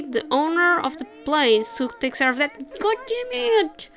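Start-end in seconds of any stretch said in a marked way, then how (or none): 2.8-3.9 high, drawn-out mock curse; hammed-up frustration, pitched high; frustrated outburst. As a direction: whine the curse slowly, up high. Frustrated at being told to wait.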